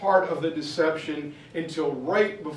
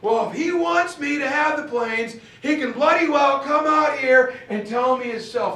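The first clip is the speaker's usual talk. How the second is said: defiant shout; 7 semitones higher, 4 dB louder than usual. Retold or imitated. Ike yelling back at the messenger.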